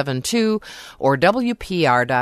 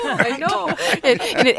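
laughing; 11 semitones higher than usual. Real, not acted. Laughing about missing event.

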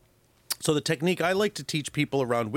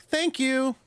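"Thank you." sung out much higher; bright upward inflection; 12 semitones higher than usual. Shown as a demonstration. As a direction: lift "Thank you." way up, cheerful and light. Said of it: "saying it with a nice upward inflection".

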